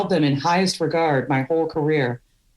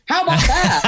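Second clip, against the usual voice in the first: mock cheery; 10 semitones higher, 4 dB louder than usual. Cheery fib to young daughter.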